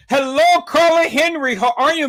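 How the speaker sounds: high, loud welcoming call; exuberant greeting. Real, not acted. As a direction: belt out a hello to a guest. Calling out greeting to viewer.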